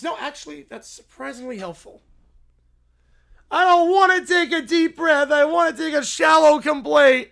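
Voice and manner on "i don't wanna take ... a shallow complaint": loud whiny refusal; spoiled child shouting back; bratty shout. Acted. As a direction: yell it like a spoiled kid refusing.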